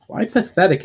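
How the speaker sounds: elf Valinor. Acted. Valinor the elf asks the demon, lightly voiced.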